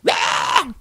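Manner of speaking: high, breathy mock protester scream. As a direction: scream like an unhinged rioter, but softly. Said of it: "they're going".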